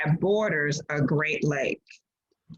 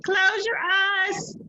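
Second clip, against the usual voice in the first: much higher and slow, drawn out "Close your eyes"; sing-song; 14 semitones higher, much slower than usual. Real, not acted. Teacher to students hiding the slide, slow high sing-song. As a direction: coax them like a game, slow and high.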